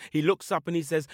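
usual speaking voice

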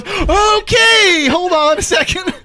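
high, loud, slowed stammer; flustered reporter. Retold or imitated. Retelling the reporter's reaction to the prank, high and loud. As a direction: play a rattled reporter trying to keep control. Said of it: "she goes".

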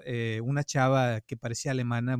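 ordinary talk, nothing marked

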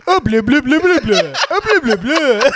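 loud mock French gibberish, laughing; fake foreign language. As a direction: blah blah in a fake French accent, high and loud. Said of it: "mocking him in a fake French language".

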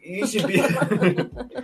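laughing tease. Pretend. Joking about baby.

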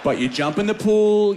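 normal talking voice

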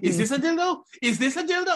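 high squeaky mock question, said twice; mock prying mother. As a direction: ham up the clueless mom's outrage. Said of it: "It's like".

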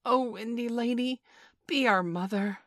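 put-on high, imploring children's cry; eager childlike appeal; crying out, pleading. A narrator's reading. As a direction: beg her, voice lifted.

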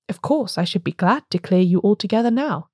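ordinary delivery

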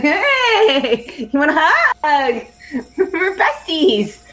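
high, drawn-out playful gushing; mock chummy. Player falls onto the nurse, mock chummy and laughing. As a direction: gush like a fake best friend, half laughing.

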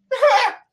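high, loud burst of mock laughter; sudden piercing fake guffaw; imitated laugh. Comic mimics an audience member in the back, loud high laugh. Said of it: "somebody in the back's like".

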